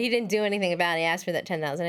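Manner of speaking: ordinary delivery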